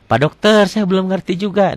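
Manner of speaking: a confused learner asking for help; pleading student. Retold or imitated. Confused pupil asks the tajwid doctor, lightly pleading. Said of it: "datang ke dokternya lagi".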